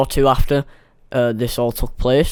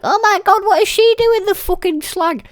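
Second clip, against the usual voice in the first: much higher, outraged squeal; mock shriek. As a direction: squeal like a scandalised teen. Shocked inner reaction quoted.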